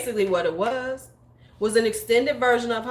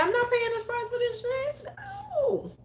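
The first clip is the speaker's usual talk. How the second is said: high, crying mock whine, then a flat No; hammed-up outraged whimper; mock whiny refusal; 5 dB quieter than usual. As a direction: whine it like a wounded shopper. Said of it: "I kept looking at her like".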